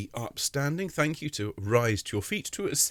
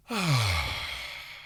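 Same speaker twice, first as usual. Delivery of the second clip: huge open sigh on ah; 3 semitones higher than usual. Shown as a demonstration. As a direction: heave a huge audible sigh. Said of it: "and a huge sigh this time".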